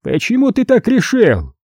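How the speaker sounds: high-pitched wary question; suspicious cardinal. A narrator's reading. Cardinal questioning his captive's confidence, suspicious and high-voiced. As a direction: lift the pitch sharply, probing with suspicion. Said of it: "подозрительно поинтересовался он".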